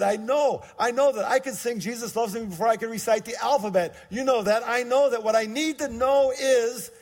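high and loud exasperated insisting; I know, just tell me already; exasperated protest. Retold or imitated. Young man protesting to God in prayer, exasperated.

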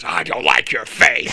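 mock Vince telling him bluntly; imitating Vince. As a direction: deliver it slowly, as a crushing verdict from above. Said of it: "And he goes".

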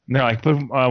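normal talking voice

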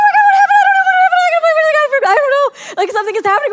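high, loud excited panic; re-living the moment in the snowbound car, excited shrieks; freaking out. Retold or imitated. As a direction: shriek it out in wild disbelief. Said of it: "my son and I were both freaking".

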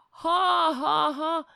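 loud, fake ha-ha-ha; put-on guffaw at a bad joke; mock laughter.

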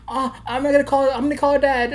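high-pitched mock maternal fretting; worried mom. Mom deciding to call Dad, mock worried. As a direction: imitate an overworried mother, voice way up. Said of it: "really worried".

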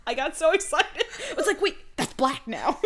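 laughing excitement. Real, not acted. Co-host tells of noticing the jacket change, laughing excitedly. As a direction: giggle through your excitement.

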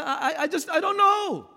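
put-on high-pitched, stammering lost little boy; mock tearful child. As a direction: imitate an upset child, rising into a wail. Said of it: "he's just crying".

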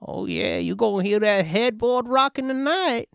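put-on breathy then high old lady; leering granny teasing; grandma voice. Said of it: "Your grandma voice".

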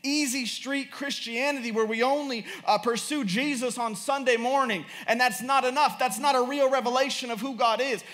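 high-pitched, driving sermon delivery; impassioned preaching. Real, not acted. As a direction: preach it urgently, pressing each point home.

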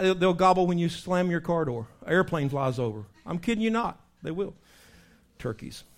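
ordinary talk

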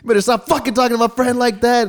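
high-pitched mock yell; retold yelling. Fan confronting a scalper for his friend, retold yelling. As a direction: re-enact yelling in someone's face, pitch shooting up. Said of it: "I was yelling at his face".

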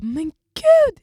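loud, high cry of retold shock; shocked exclamation. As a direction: exclaim as if finding a friend on the floor. Retold shock at finding friend. (chockat utrop)

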